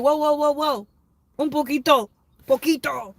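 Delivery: loud, high panicked pleading; flustered. Retold or imitated. When someone speaks Spanish to her, loud flustered pleading. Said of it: "I'm like".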